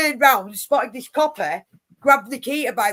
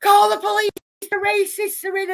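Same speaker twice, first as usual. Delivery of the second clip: high, loud panicked-crowd cry; screaming; 9 semitones higher, 5 dB louder than usual. Retold or imitated. Storyteller mimicking alarmed bystanders, high-pitched screaming. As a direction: imitate people screaming for help. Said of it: "There was people screaming".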